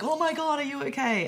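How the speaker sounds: high, loud mock-alarmed exclamation; exclaiming parent. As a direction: imitate a parent overreacting to a fall. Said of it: "exclaim and be like".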